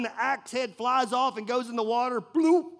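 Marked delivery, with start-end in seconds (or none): 2.2-2.7 a quick high loud bloop; splash noise. Retold or imitated. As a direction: pop the splash sound out high. Axe head falls in water.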